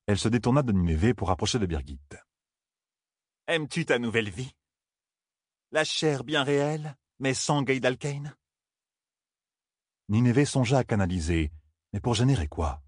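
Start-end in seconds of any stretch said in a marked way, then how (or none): 3.5-8.3 taunting villain. A narrator's reading. Villain taunts captive heroine.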